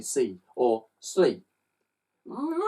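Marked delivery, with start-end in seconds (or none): 2.2-2.6 high rising yowl; cats screaming. Acted. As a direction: yowl like a frightened cat. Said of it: "screamed the cats".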